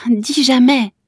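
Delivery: a last urgent breathless dis jamais; breathless plea. A narrator's reading. Kezia clinging to her grandmother, breathless and urgent. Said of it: "Elle haletait entre les baisers".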